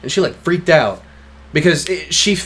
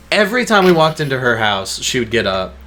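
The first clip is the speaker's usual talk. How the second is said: ordinary delivery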